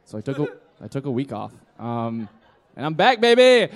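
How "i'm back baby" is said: loud, high, hyped-up cry; triumphant announcement. Real, not acted. Drinker announcing a comeback to the crowd, loud and triumphant. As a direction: crow it like a comeback.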